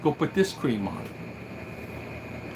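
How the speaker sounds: a hushed, slow doctor's order; breathy, almost whispered instruction; doctor's advice. Retold or imitated. First doctor telling Tom to use cream, hushed and slow. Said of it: "the first doctor said".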